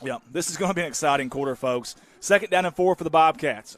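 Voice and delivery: usual speaking voice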